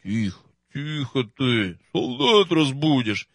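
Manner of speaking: медленно, тише, басом большого мужика; осади его негромко и медленно; прогудел. Acted. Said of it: "Прогудел огромный". (медленный гул генерала, слегка басом)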